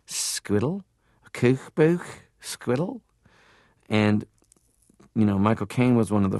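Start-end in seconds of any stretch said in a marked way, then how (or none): 0.1-3.0 high, lilting "Squiddle, kookbook, squiddle"; Liverpool pronunciation. Retold or imitated. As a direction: imitate Liverpool "kookbook" with long oo, "squiddle" rising. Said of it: "my dad had a Liverpool accent".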